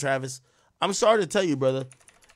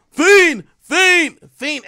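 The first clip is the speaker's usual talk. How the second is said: high, loud hype shouts that fade out; hammed-up hype-man yelling; mock ad-lib yells; 13 semitones higher, 13 dB louder, much slower than usual. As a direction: yell it like a rap ad-lib, then let it drop. Mocking [name]'s Rager ad-libs.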